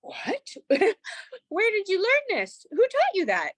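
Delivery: delighted shock at her son; amazed. Retold or imitated. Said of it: "I was like".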